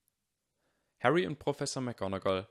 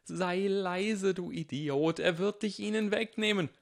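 much higher, louder urgent hushing scream; frantic inner yell; 10 semitones higher, 3 dB louder than usual. A narrator's reading. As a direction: scream a warning inside his head. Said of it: "schrie so laut er konnte".

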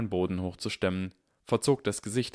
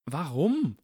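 indignant Harry; 8 semitones higher than usual. Acted. Harry's protest.

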